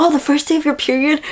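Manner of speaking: quick, high puzzled question; mock confused boyfriend, high and fast; surprised question. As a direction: ask it like he just got it. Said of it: "He's like".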